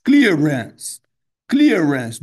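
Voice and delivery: "Clearance." firm, two syllables; two syllables, loud. Shown as a demonstration. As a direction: punch "Clearance." out in two beats. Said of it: "Duas sílabas".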